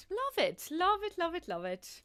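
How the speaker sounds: high mock delight, then flat; sarcastic. Real, not acted. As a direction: gush sarcastically over awful colors. Sarcastic after worst colors.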